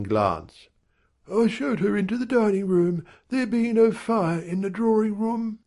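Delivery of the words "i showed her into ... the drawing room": high, put-on servant voice; a butler reporting with a blank look; Hawken's high voice. A narrator's reading. Butler to Rawdon, high and blank. Hawken explains to his master.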